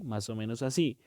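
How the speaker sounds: ordinary talk, nothing marked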